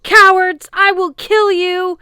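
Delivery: loud, high, slow raging roar; furious giant shout. A narrator's reading. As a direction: bellow the threat. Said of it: "as Girod shouted".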